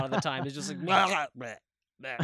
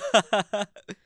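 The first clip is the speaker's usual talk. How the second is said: loud higher taunting laugh; mocking laugh; 5 semitones higher, 5 dB louder than usual. Southerners mocking him, a loud jeering laugh. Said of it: "They always go like".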